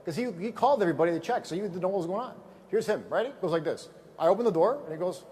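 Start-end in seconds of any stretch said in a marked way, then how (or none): none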